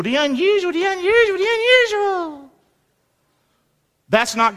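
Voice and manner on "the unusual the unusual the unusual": climbing higher and higher with a crying mock wail on unusual; mock rising wail. Preacher mocking thrill-seekers with a rising, wailing unusual. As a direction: ham up the craving for the unusual, pitch rising each time.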